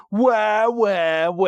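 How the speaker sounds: cartoonish "wah" crying noises; mock baby wail. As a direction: imitate a crybaby for laughs. Imitates baby Pokemon crying.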